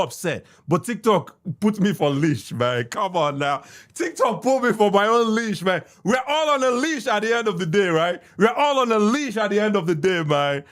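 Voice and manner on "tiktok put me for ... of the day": normal talking voice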